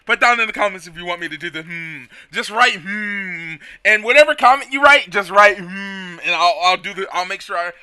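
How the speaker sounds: ordinary talk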